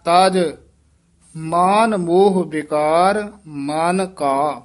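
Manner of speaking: slow down and keep ਮਨ ਕਾ apart; slow, careful split phrase; separate ਮਨ ਕਾ. Shown as a demonstration. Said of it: "ਮਨ ਕਾ ਇਕੱਠਾ ਨਹੀਂ ਕਹਿ ਦੇਣਾ".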